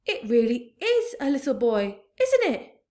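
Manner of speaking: rising high amazed question; astonished. A narrator's reading. Fire chief amazed to see a boy. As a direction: marvel at it aloud.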